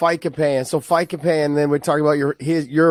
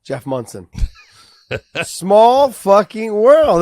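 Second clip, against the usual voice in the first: ordinary talk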